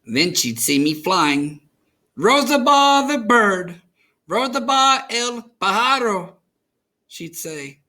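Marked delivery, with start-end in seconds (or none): 2.1-6.4 abuela calling out. A narrator's reading. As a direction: call up to the bird-girl with delight. Abuela calls the flying girl.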